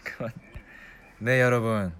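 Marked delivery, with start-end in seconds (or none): 0.0-0.3 whisper. Real, not acted. Whispered aside before greeting.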